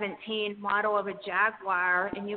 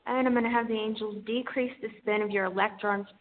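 airy breathy mystical healer. Acted. Guides an energy healing session.

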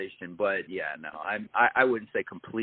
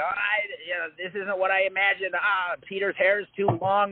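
mock whiny fan complaint, pitched higher; 9 semitones higher than usual. Mocking fans of the books who complain, high whiny fan voice. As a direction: imitate a fussy book fan griping. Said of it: "they go".

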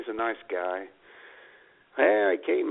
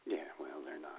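whisper; 8 semitones lower, 17 dB quieter than usual. Real, not acted. Whispered aside about Joni and Chachi.

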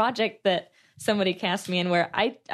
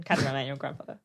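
corrected movie line; 5 semitones lower, 4 dB quieter, much faster than usual. Shown as a demonstration. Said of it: "That was Catherine".

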